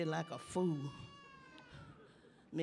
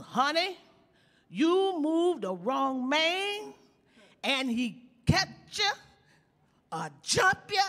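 building excitement; 9 semitones higher, 5 dB louder, slower than usual. Acted. Mom's advice rising to climax.

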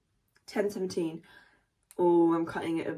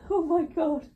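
high squeal; 9 semitones higher, 7 dB louder than usual. Real, not acted. Embarrassed squeal after rambling.

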